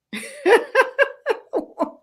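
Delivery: high, real peal of laughter; hearty laugh. Real, not acted. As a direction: burst out laughing at your own odd remark. Laughs at feeling good cursed.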